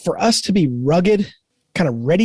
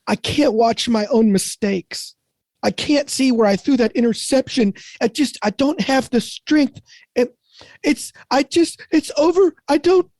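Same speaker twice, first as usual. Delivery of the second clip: mock sobbing whine, higher and climbing steadily higher; mock crying; 9 semitones higher than usual. A quarterback crying in the film room, hammed-up whimper. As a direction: play a tearful quarterback who can't face the film. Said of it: "curls up in a ball and just starts crying".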